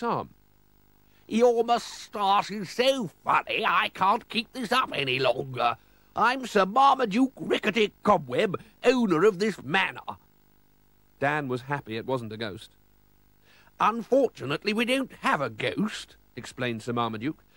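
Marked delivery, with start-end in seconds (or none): 1.3-10.2 high, loud, theatrical aristocrat; jolly lord of the manor. Acted. Sir Marmaduke owning up to the visitors, high, loud comic lord voice. 13.8-16.2 explaining lord. Acted. Manor owner explaining to his visitors, high comic lord voice.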